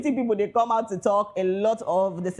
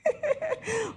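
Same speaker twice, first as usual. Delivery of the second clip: soft laugh; 13 semitones higher, 5 dB quieter than usual. Real, not acted. Laugh after teasing aside.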